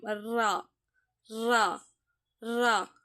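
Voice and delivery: "ரா" with a rolled r, each one separate; articulation demo. Shown as a demonstration. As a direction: roll the r of "ரா" on the tongue, lips kept apart. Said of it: "நாவின் வலது ஓரம்". ("ரா" நாக்கை உருட்டி தனித்தனியாக உச்சரித்தல்)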